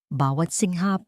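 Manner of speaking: ordinary talk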